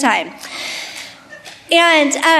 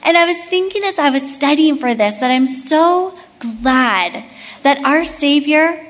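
normal talking voice

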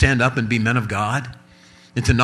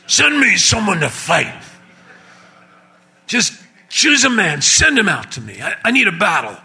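high, put-on giant taunt; Ken Graves giant voice; 7 semitones higher than usual. Giant taunting Israel's army, high mock voice. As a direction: voice the giant calling for a fighter. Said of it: "did that sound a little bit like Ken Graves".

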